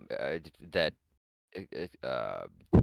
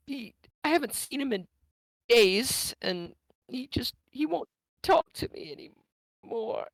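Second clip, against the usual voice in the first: sad lady. Acted.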